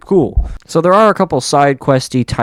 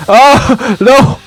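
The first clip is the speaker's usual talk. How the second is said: loud, high cry of alarm; 11 semitones higher, 9 dB louder than usual. Real, not acted. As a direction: yelp it out in panic. Panics at falling in potion.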